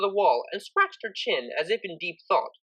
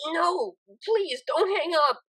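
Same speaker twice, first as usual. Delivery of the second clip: high, pleading through sniffles; tearful mare pleading on the phone; sniffling plea; 11 semitones higher, slower than usual. Acted. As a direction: beg tearfully not to be hung up on. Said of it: "sniffled a familiar mare".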